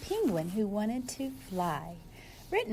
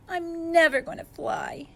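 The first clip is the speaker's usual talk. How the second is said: cried; 6 semitones higher, 5 dB louder than usual. Acted. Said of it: "he cried".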